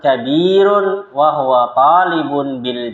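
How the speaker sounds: slow, high, careful Arabic dialogue reading; slow lesson reading. Shown as a demonstration. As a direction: read each line slowly for students to repeat. Dialogue drill.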